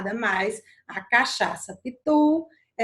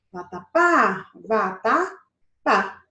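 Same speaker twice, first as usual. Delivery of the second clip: "vatapá" stretched slowly, resting on "pá"; stress on final "pá"; 3 semitones lower, 3 dB louder, much slower than usual. Shown as a demonstration. Teacher demonstrating the last syllable, stretched "pá". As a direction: break up "vatapá" and lean on "pá". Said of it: "Se tá na última sílaba".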